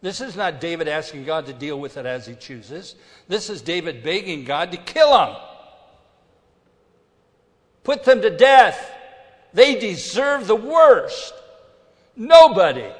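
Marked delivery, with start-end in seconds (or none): 3.3-12.9 loud, high pulpit thunder, slowing; full-force preaching. Acted. Preacher condemns David's vengeful prayer, loud thunder. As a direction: preach it hard to the rafters.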